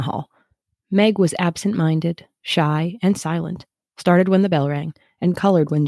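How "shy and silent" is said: plain reading voice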